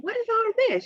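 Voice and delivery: high, bewildered question to mom; reliving her disbelief. Retold or imitated. Asking her mother about the tubes, high bewildered question. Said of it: "I said".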